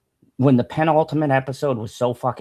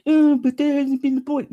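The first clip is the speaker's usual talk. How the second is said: mocking whiny critic; 12 semitones higher than usual.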